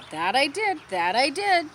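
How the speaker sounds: an eager goose saying it twice; repeating goose. Acted. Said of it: "she kind of repeats herself".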